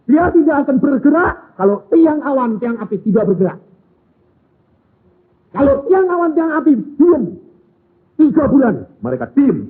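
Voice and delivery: high, loud, driving exhortation; loud pulpit questions; preaching at full force. Acted. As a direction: hammer the question home to the congregation. Preacher builds on Israel story.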